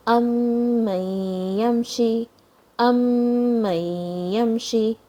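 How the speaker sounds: "أمن يمشي" with a long, held nasal hum on the meem; strong ghunna. Shown as a demonstration. Teacher modelling a strong gunna, slow nasal hold in "أمن يمشي". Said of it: "is a strong gunna here".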